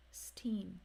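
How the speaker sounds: steen with S gliding into T; S and T linked as one sound in steen; isolated steen. Shown as a demonstration. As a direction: run the S straight into teen. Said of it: "the S sound connects with the T".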